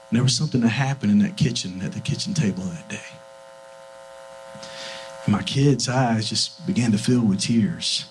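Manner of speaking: low, soft and reverent; hushed, moved recollection. Real, not acted. Father recalling his kids' tears, hushed and low. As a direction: drop low and tell it softly.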